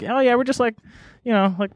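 high-pitched, casual little dude; comic impression, starting high and easing down; Aziz Ansari. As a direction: imitate a chipper guy shrugging it off. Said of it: "picture Aziz coming up".

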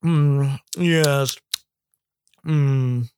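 lightly voiced snooty taster; pretentious beer snob. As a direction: savor each word like a wine snob. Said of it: "Like a pretentious beer snob".

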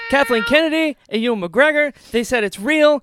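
loud, high, bursting with joy; overjoyed. Real, not acted. Fan telling co-host Kenobi is real, loud and overjoyed. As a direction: announce it like the best news ever.

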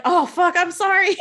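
lightly acted shocked apology; startled apology. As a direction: blurt the sorry like you just hit someone. Snowball hits her face.

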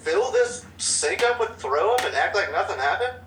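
retold disbelief. Telling friends about the vomit sink, incredulous high outrage. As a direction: voice the disgust at the sink.

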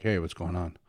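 low announcer-style greeting; lightly imitated announcer. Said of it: "had an announcer voice".

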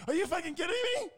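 very high, fast, loud squeal; mock disbelief. To his buddy at the roadside, a high squealing disbelief. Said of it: "I went".

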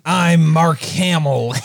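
hammed-up cartoon Joker; loud cartoony Joker; Mark Hamill Joker. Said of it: "He sounds like".

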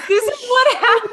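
laughing. Real, not acted. Laughing at the singer.

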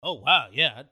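imitating a shocked interviewee; mock surprise. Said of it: "they're just all like".

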